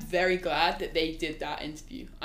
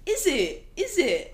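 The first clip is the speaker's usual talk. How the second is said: rising mock "Is it?"; higher quizzical reply; imitating Raph; 8 semitones higher, much slower than usual. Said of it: "Raph says this a lot".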